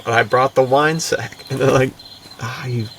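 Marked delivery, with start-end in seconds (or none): none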